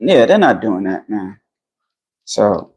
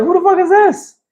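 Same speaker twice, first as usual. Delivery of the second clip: much higher mock disbelief; pitching up high in disbelief at the opponent; incredulous. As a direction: squeal the question in disbelief. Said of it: "I was like".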